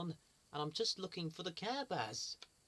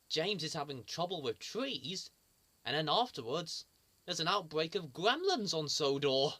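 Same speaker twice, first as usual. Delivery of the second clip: ordinary delivery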